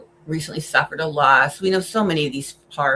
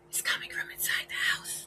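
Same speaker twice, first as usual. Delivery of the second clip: hushed horror-trailer whisper, fading softer; spooky whisper; 10 dB quieter than usual. Pretend. Vlogger teasing viewers with a horror twist, whispered. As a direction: whisper it like a scary tagline.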